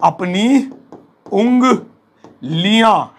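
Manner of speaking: "अपनी उंगलियां" slowly, with a pause between the words; teacher's slow repeat of "अपनी उंगलियां". Shown as a demonstration. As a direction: break up "अपनी उंगलियां" and stretch it out. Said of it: "है ना".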